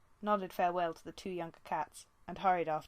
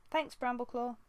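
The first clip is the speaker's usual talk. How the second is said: put-on a higher young she-cat voice; a strained apprentice tugging free; Squirrelpaw, lightly voiced; 6 semitones higher than usual. A narrator's reading. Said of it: "she meowed".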